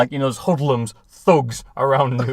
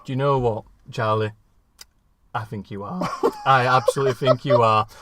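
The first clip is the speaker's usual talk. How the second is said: put-on lower, softer, earnest old actor voice; mock Ian McKellen; 5 semitones lower, 5 dB quieter than usual. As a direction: murmur it sincerely like a wise old friend. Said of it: "He goes".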